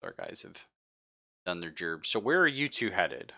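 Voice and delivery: ordinary delivery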